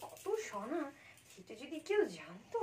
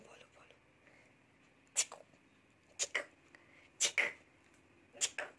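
whisper; faster than usual. Real, not acted. Mother whispering to baby. (ফিসফিস করে আদুরে তাগাদা)

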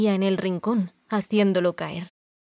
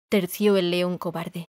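usual speaking voice

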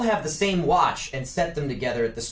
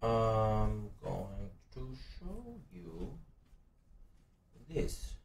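secretive hush; 5 semitones lower, 14 dB quieter, slower than usual. Acted. Hushed secret card reveal.

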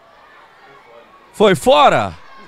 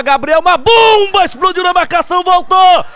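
high rising near-miss call; excited shout; 5 semitones higher than usual. Real, not acted. Near miss live commentary.

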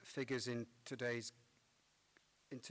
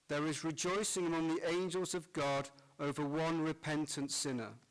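cathedral echo; 3 semitones higher, 21 dB louder than usual. Acted. Priest proclaims Gospel in church.

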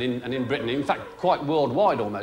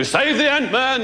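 hippie slogan; 8 semitones higher, 3 dB louder, slower than usual. Retold or imitated. Mocking Joe's eco slogan.

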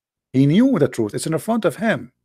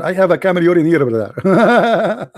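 laughing; 5 semitones higher, 3 dB louder than usual. Real, not acted. Mocking the opponent, laughing.

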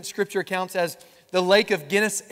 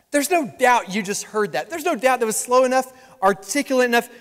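normal talking voice

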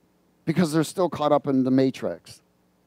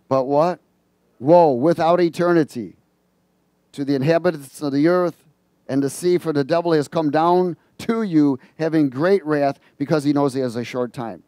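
scripture reading; 5 dB louder than usual. Acted. Reads scripture, prompting congregation.

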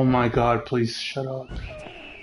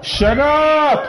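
high, loud angry yell; 11 semitones higher, 6 dB louder than usual. Real, not acted. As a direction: scream it at the monster. Furious at the noise.

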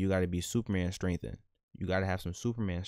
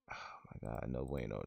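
breathed oh my God, barely voiced; a stunned whispered gasp; horrified whisper; 8 semitones lower, 11 dB quieter than usual. Real, not acted. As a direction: whisper it in disbelief. Horrified at the scene.

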